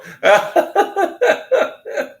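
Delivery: high, giggling laugh falling away. Real, not acted. Streamer giggling at his dead mic light, high laugh. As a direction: let a giggle run up high.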